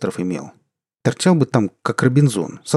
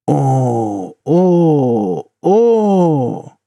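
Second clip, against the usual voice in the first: put-on rising drunken wail, louder each time; 5 semitones higher, 6 dB louder, much slower than usual. Drunk wailing.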